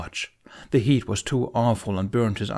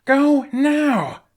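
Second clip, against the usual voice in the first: much higher, much louder yell; roar; 8 dB louder than usual. A narrator's reading. Hiccup ordering the Vikings away, roaring. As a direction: roar it down at the ships. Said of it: "Hiccup roared downward".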